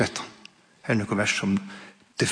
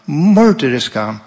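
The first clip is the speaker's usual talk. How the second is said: slow, louder punch on morderiska; heavy emphasis; 3 semitones higher, 7 dB louder, slower than usual. Real, not acted. Preacher to congregation, condemning Rome with weight. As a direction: lean hard into morderiska. Listing Rome's violence.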